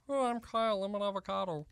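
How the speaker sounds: soft, groaning caricature of a coworker; dragging, put-upon mockery; mimicked Kyle impression. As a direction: mope through a mock self-portrait. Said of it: "when you do your impression of me".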